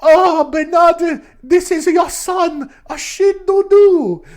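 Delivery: high, loud, drawn-out character voice; hammed-up proud new parent. Shi presenting the baby to Bernard, hammed-up high voice. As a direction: announce the baby in a big, slow mock-melodramatic voice. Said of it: "So they're like".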